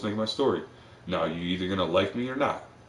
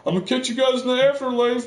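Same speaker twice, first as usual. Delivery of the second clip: high, loud cartoonish goodbye; doomed guy waving off his friends, high and chirpy; mock cheerful farewell; 5 dB louder than usual. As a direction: sing out a breezy last goodbye.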